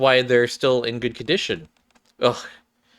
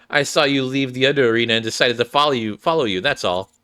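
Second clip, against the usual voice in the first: normal talking voice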